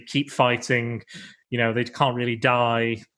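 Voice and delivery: usual speaking voice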